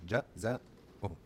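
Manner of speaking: Jazauhum stretched out very slowly, each syllable held; sounded out slowly. Shown as a demonstration. Teacher sounding out the word slowly for learners. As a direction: drag out Jazauhum so the fatha is heard. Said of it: "You start noticing the fatha".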